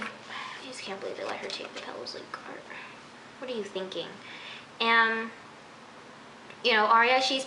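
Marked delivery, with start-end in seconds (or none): 0.0-4.1 hushed, exasperated whisper; frustrated whisper. Real, not acted. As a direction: breathe it out, fed up. Disbelief at a plot twist.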